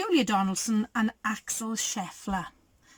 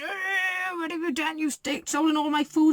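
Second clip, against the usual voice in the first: higher, crying traveller voice; 6 semitones higher than usual. Retold or imitated. A traveller cries at the rat, higher and whiny. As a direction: wail like a robbed traveller. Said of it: "And he's going".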